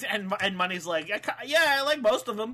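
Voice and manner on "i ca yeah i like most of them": higher-pitched, stumbling answer; retold flustered reply. Money answering the taunt, mock flustered. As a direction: stammer into a raised admission.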